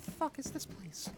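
high unvoiced whisper. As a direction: hiss it quietly. Kid hiding, whispering.

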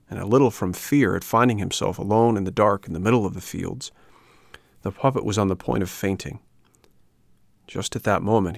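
ordinary delivery

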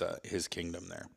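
normal talking voice

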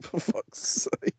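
whispered, very slow curse under the breath; muttered curse. Retold or imitated. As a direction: mutter it to yourself, beaten. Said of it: "I was like".

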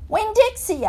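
high, loud call to a dog. A narrator's reading. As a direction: call the runaway dog by name. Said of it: "I called".

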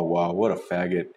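normal talking voice

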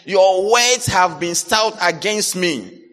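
loud, high call to the congregation; shouting encouragement. Real, not acted. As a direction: shout it out to the crowd.

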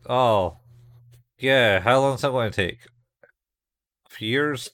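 hammed-up scornful disbelief; dismissive scoff. Roberto scoffing at the terraform plan, fast mocking rise. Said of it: "Roberto's basically like".

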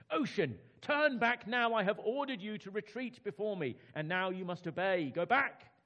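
high, loud shouted orders; angry command. Acted. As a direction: roar at the ocean to retreat. King shouting at the sea.

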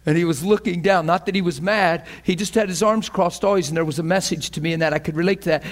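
ordinary talk, nothing marked

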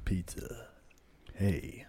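breathy seductive whisper; sexy whisper. Shown as a demonstration. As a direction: whisper it like a come-on. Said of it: "talk like this".

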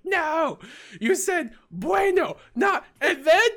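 high shrieking tantrum, laughing through it; imitated tantrum. Teacher losing it over eighty bucks, a shrill laughing tantrum. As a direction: throw a squealing fit while cracking up. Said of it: "starts throwing the tantrum".

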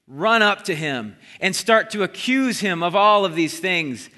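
usual speaking voice

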